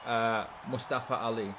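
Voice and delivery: ordinary talk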